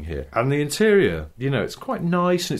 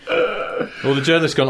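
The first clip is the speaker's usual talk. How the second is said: ordinary talk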